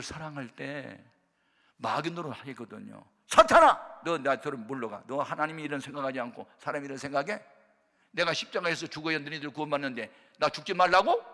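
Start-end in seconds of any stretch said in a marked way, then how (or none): 3.3-11.3 explosive shout easing into loud rebuke; acted scolding. Jesus rebuking Peter as preacher acts it, loud scolding. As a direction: burst out, then berate indignantly. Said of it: "막 그러면서 지금 야단친".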